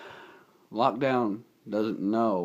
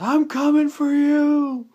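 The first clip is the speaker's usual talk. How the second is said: much higher, loud, slow threatening yell; villain shout; 11 dB louder, slower than usual. Retold or imitated. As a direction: roar a threat across the rooftops. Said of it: "Titus says".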